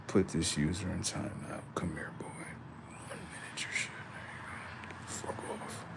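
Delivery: hushed muttered aside; unvoiced off-mic grumble; whisper. Real, not acted. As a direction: mutter it under your breath. Muttering while moderating chat.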